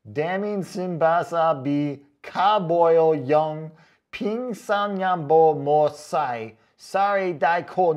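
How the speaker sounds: slow, loud chant of the garbled words as if foreign; drawn-out mock foreign reading. Shown as a demonstration. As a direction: sound it out like a strange foreign tongue. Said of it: "No, that was in English".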